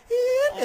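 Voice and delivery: high playful squeal; teasing squeal. Retold or imitated. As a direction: squeak it out like a dodging shark. Shark evading, imitated sound.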